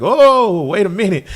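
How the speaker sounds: big drawn "oh" of mock surprise; imitating surprised listeners. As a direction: act out a listener caught off guard. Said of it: "everybody that just heard that and was like".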